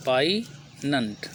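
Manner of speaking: "पाइनेंट" very slow, split as poi-nant; silent g. Shown as a demonstration. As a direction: sound out "पाइनेंट" in two slow parts, no g. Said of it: "जी silent होता है".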